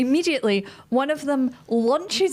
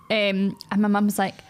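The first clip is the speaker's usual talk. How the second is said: ordinary talk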